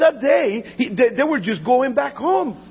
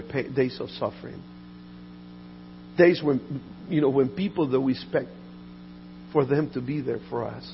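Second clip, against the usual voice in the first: soft reflective preaching; 8 semitones lower, 8 dB quieter than usual. Acted. Soft reflective sermon passage over music.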